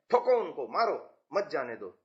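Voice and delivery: demonstrated command, falling through मत जाने दो; mock order. Shown as a demonstration. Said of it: "तो हम सेना को कह रहे हैं".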